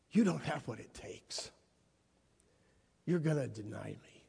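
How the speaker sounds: soft, low, sorrowful words; quiet reproach. Acted. Jesus silently telling Peter he will fail, quiet reproach. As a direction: let it land softly.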